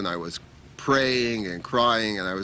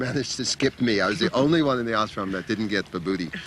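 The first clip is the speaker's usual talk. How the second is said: ordinary delivery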